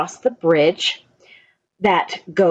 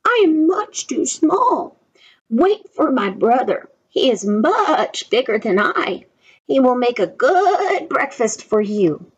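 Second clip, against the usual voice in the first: much higher, pleading little goat; small goat pleading; 6 semitones higher, slower than usual. A narrator's reading. Little goat begging the troll, high pleading voice. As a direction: beg the troll in a tiny voice. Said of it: "he said".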